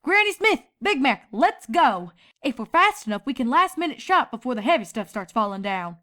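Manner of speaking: lightly voiced Applejack calling out. A narrator's reading. Applejack calls family out.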